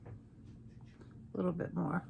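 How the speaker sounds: whisper. Real, not acted. Quiet aside while blending.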